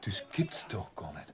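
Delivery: low, unvoiced disbelieving whisper; stunned whisper. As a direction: breathe it out in shock. Verblüffte Erkenntnis. (fassungsloses Flüstern)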